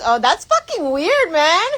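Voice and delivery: high, slow surprised guy; a hint of a baffled guy. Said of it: "he goes".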